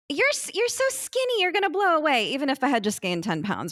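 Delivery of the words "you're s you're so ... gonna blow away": high-pitched doting mother, lightly voiced; a hint of a fretting mother, pitched up; doting mom. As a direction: mimic a fussing mom who thinks you're too thin. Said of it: "she was one of those moms who was like".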